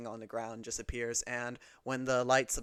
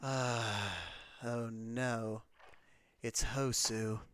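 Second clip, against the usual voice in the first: eye-rolling dismay; 2 semitones lower, 6 dB quieter, much slower than usual. Acted. Raven Queen recognises the cat, understated eye-rolling dismay. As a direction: sigh it out, unimpressed. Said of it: "rolls her eyes and goes".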